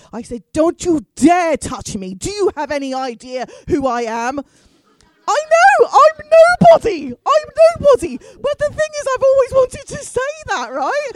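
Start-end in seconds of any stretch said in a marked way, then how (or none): 0.5-4.4 slow, loud, haughty colonial lady; English Mem Saab voice. Retold or imitated. To the policeman, a haughty memsahib voice. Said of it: "in my best English Mem Saab voice". 5.3-11.1 giddy aside. Real, not acted. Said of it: "excited laughing aside".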